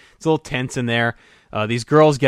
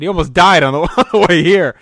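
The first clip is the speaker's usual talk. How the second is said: raised, louder, breaking into a laugh; amused exclamation; 5 semitones higher, 7 dB louder than usual. Retold or imitated. Telling his wife about the near fall, amused and laughing. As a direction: laugh through the retold punchline.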